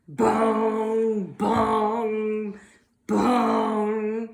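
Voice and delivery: loud booming bell sound; bell bong. Acted. Imitating the church bell under the nest. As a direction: boom it like a big church bell.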